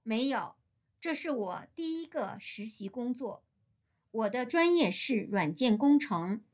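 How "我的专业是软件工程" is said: ordinary delivery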